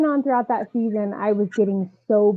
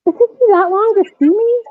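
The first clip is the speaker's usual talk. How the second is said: high, loud, stunned question; hurt disbelief; 12 semitones higher, 6 dB louder than usual. Retold or imitated. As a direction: let the disbelief burst out, hurt. Retorting in disbelief to coach.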